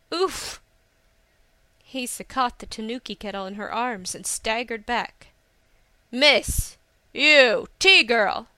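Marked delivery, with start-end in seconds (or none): 0.1-0.6 high, short "oof"; knocked-back grunt; impact grunt. A narrator's reading. Hisa catches the hurtling kettle. 6.1-8.5 loud, separated shouts for attention; angry young lord shouting; imperious calling out. A narrator's reading. Angry rider hails Hisa.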